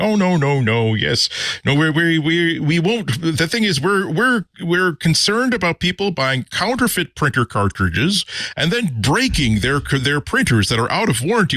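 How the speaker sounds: hammed-up company rep dodging the question; mock corporate spokesman. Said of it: "every manufacturer saying".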